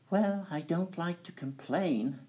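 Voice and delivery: high, dainty princess voice; squeaky little princess complaining; cartoon princess. A narrator's reading. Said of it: "said the princess".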